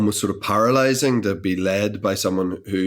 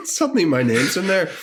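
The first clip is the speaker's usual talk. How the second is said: higher, laughing disbelief; 9 semitones higher than usual. Real, not acted. Actor tells interviewer about the review, laughing.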